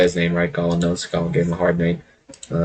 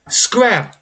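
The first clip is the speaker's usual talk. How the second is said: much higher snapped dismissal; haughty dismissal; 13 semitones higher than usual. Acted. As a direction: flick it away at the servant. Lich dismissing a person.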